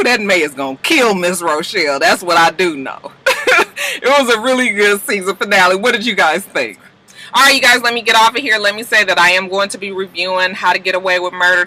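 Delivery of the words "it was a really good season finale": high laugh into speech. Real, not acted. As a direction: laugh through the line. Laughing wrap-up.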